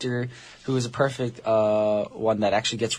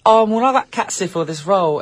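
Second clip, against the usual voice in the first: put-on British accent; 6 semitones higher than usual. Mock British movie announcement.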